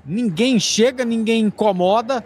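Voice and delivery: fast radio match call; live play-by-play. Acted. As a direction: narrate the attack live.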